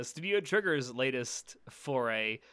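normal talking voice

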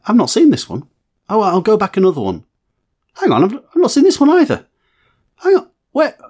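rising, baffled disbelief; high, incredulous double take; surprised. Retold or imitated. Retelling confused binge-watching realisation.

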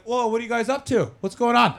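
breezy curiosity. Pretend. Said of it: "and I would just be like".

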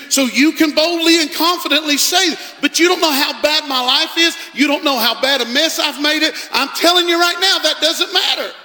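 loud, high rallying preach; preaching at full force. Acted. Pastor urging lonely folks they will be helped, loud and high. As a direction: lift the room with every line.

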